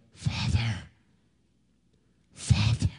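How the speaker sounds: faint, failing voice fading to breath; barely voiced plea from a wounded boy; weak dying cry. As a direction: call out with almost no strength left. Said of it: "more dead than alive he was, and cried out".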